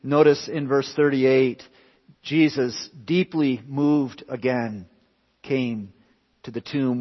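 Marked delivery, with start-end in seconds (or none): none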